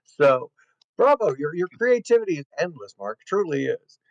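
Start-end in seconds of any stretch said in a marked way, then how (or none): none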